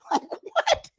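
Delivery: squeaks way up through a laugh; laughing disbelief. Real, not acted. Mocking the TikToker's logic, high laughing disbelief.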